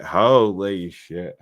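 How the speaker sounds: very slow stretched holy shit; tipsy slow drawl on holy shit; drawn out. Real, not acted. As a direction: drag out holy shit lazily. Repeats exclamation, drunk on birthday.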